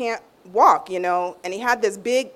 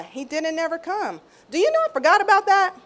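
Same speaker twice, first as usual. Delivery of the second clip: ordinary delivery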